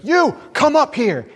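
high, drawn-out summons across the room; calling out. Retold or imitated. Evangelist summoning a man from the back, calling out. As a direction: call them up front, stretching each word.

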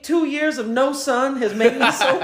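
high-pitched, drawn-out disbelief; mock-aghast question, voice held way up high; incredulous. Prisoner asking cellmates how he got so pale, incredulous.

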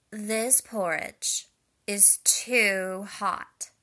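put-on loud, slow little-girl complaint; Goldilocks exclaiming. A narrator's reading. Goldilocks tasting hot porridge, loud slow exclamation. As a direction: drag out the protest at the hot bowl. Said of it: "she exclaimed".

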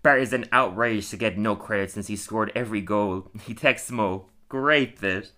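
ordinary talk

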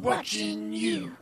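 slow whispered voice effect; creepy whispered effect. Pretend. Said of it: "that's the wrong effect".

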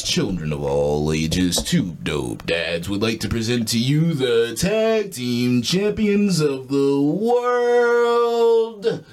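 booming ring announcer intro; ringmaster. Pretend. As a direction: draw out each phrase like a showman. Podcast intro, wrestling-style announcement.

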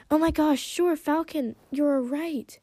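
high, excited outburst; loud and high, gushing at returning friends; bursting out. A narrator's reading. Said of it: "Sunny bursted".

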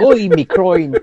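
high, loud thick Irish cry; an Irishman just hit in the balls; pained Irish accent. Acted. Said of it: "Reminds me of an Irishman being hit in the balls".

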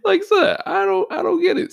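high, loud, half-sobbing disbelief; mock crying. Real, not acted. Vlogger baffled at angry rioters, in a mock wail. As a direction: fake a bewildered wail.

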